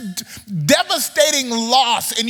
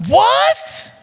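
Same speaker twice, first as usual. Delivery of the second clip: high, loud shocked what; outraged what; 12 semitones higher, 4 dB louder than usual. Acted. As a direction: shoot up in disbelief. Reacting to the mock blessing.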